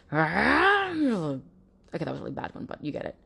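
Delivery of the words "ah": short high, louder mock rage shout; mock angry yell. Host imitating a partner's outburst, hammed-up yell. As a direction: blurt out a hammed-up furious yell. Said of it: "making loud noises and swearing like".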